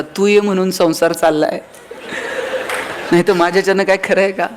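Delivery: lightly hammed film-hero charm; husband woos his angry wife with a filmy line; filmy romantic dialogue. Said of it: "एक dialogue मारायचा".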